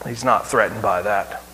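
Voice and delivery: ordinary delivery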